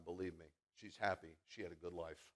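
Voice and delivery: ordinary talk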